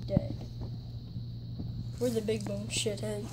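normal talking voice

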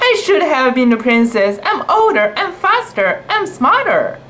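high, fast whiny kitten listing grievances; jealous complaint. A narrator's reading. Satwa complaining to Fufu she deserves the crown. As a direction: huff out every reason she deserves it.

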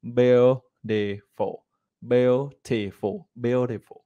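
"Beautiful" drawn out very slowly; slow teacher demo; slow model. Shown as a demonstration. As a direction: drill it slowly. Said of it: "Phát âm được".

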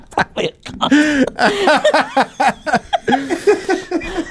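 cackling high laughter; long high laugh. Real, not acted. Laughing at his own headbutt joke, high and long. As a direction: let a long laugh roll out.

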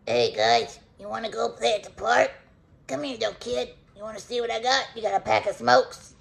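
lightly voiced shady Uncle Sal; a hint of a sleazy uncle. Owner giving her dog a lightly voiced shady uncle voice. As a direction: play it like a dodgy uncle luring kids. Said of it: "that's what he reminds me of".

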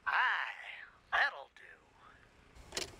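put-on whispered Scottish-accent aye; unvoiced Scottish whisper to himself. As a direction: breathe it, satisfied, in a Scottish accent. Settling on the accent.

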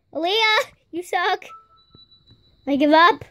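ordinary talk

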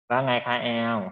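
usual speaking voice

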